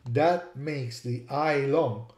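ordinary talk

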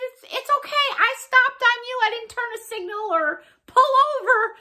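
high-pitched, hurried mock reply; retold reassurance. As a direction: re-enact excusing yourself in a high voice. Acts out own reply to shopper.

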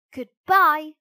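a bright, loud "Goodbye.". Acted. Pinocchio storming off from the fox, loud goodbye. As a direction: fling out "Goodbye." high and loud.

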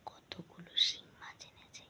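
late-night hushed whisper. Real, not acted. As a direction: whisper so no one wakes. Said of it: "কেন এত আস্তে আস্তে কথা বলছি".

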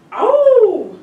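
high, loud howling wolf. A narrator's reading. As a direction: throw back your head and howl. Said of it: "Le loup le poursuit et hurle". (hurlement de loup aigu et fort)

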